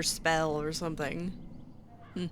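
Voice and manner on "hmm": usual speaking voice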